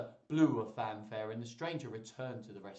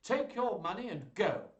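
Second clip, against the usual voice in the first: loud, raised-pitch dismissal; angry duke; 8 semitones higher, 5 dB louder than usual. Acted. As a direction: send him away in a temper.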